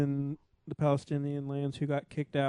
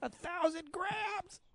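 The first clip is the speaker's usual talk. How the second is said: squeaky high voice; 8 dB quieter than usual. Acted. Mock outrage at grams joke.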